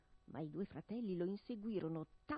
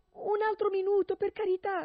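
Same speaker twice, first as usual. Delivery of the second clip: pleading wife; 13 semitones higher, 10 dB louder than usual. Acted. Wife begs for more time.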